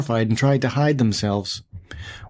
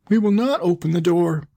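put-on high young-goat voices refusing; kids crying out; 7 semitones higher than usual. A narrator's reading. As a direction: cry out as a defiant little kid. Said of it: "cried they".

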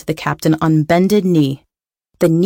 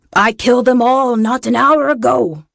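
raised, put-on captain's wail; pirate captain; 6 semitones higher than usual. A narrator's reading. Pirate captain confesses to the lad, wailing in character. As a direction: wail the confession in a high rough voice.

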